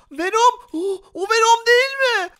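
loud, high-pitched exclamation; shocked discovery. Pretend. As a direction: cry out in stammering surprise. Spots the villain Venom. (şaşkınlıkla bağırma)

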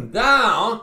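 down, shouted high and loud; emphatic shout. Acted. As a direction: hurl the word down, like throwing him down. Preacher repeating down for emphasis.

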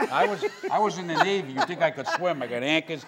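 loud, high comic outburst; panicked retold protest. Comic retelling his bachelor party scare, yelling in panic. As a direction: build to a high-pitched yell of mock panic.

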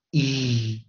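"E" and "O" drawn out loudly, each three times; vowels only. Shown as a demonstration. Teacher leading students through vowels only, loud spaced drill. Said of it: "我们只念元音啊".

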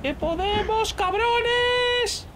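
high, pumped-up shout; rallying cry. Real, not acted. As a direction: fire up the team. Rallying teammates during hunt.

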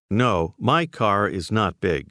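slow, measured denial leaning on "my"; emphatic denial. Shown as a demonstration. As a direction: stress on "my". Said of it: "Say emphatically".

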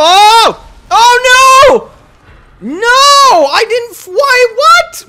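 real high loud panicked yelling; frantic yell. Real, not acted. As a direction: shout in panic. Dying in game, yelling.

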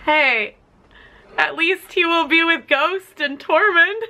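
ordinary talk, nothing marked

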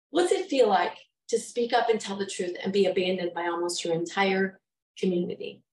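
ordinary talk, nothing marked

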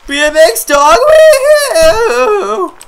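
put-on high, loud whooping kid surfer; prepubescent surfer dude. Dad turned surfer dude on a bike, high whoop. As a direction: whoop like a boy surfer mid-ride. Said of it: "A prepubescent surfer dude".

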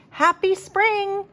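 high, slow, falsely cheerful greeting; lying cheerfully. A narrator's reading. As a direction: sell the lie with a sing-song. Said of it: "he lied".

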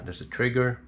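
usual speaking voice

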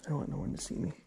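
hushed whisper, sneaking around. Real, not acted. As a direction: whisper so nobody hears. Sneaking, doesn't want to be seen.

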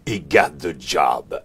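slow, loud big voice, imitated; grosse voix imitée. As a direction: play the big booming voice in the background. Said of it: "la grosse voix qui dit". (grosse voix lente et forte, imitée)

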